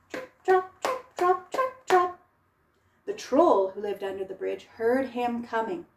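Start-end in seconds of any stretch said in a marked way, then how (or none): 0.1-2.2 high, loud sing-song trip trop; light little hoofsteps. Acted. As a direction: make tiny dainty footsteps.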